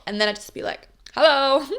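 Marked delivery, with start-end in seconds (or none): 1.1-1.6 bright, loud, higher hello; expressive hello. Shown as a demonstration. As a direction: announce yourself boldly. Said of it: "doing it more overtly and being even more expressive".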